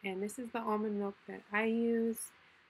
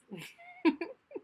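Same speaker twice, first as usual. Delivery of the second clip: bright burst of laughter; high laugh. Real, not acted. Laughs after pitching pie.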